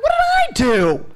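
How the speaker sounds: hammed-up baffled cry; mock indignant disbelief. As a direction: plead innocence in acted outrage. Protests to husband at being singled out.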